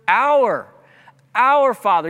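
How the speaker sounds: OUR hit loud and high; preacher landing hard on "our"; stress on OUR. Real, not acted. Emphasizing the prayer's first word.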